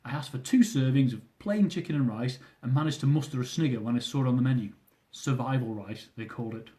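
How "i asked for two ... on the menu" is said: plain reading voice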